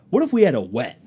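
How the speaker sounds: loud burst of a proposal; mock earnest suggestion; acted-out provocative pitch. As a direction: throw out a big idea loudly. Acting out worker's odd pitch.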